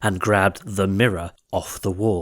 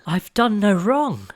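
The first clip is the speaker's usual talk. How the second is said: high, desperate plea; crying out; 10 semitones higher than usual. A narrator's reading. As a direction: beg for your life. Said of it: "She cried again".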